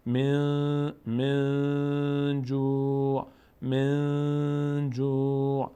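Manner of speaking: من جوع slowly recited, the n hummed through the nose; stretched, level-toned من جوع with nasal n; nasal hidden nun. Shown as a demonstration. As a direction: hold the n of من long in the nose before جوع.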